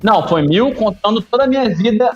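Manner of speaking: laughing. Real, not acted. Joking about keepy-ups.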